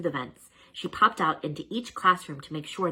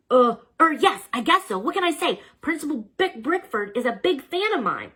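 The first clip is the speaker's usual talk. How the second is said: flustered; 10 semitones higher, 6 dB louder than usual. A narrator's reading. Flustered about the drawings.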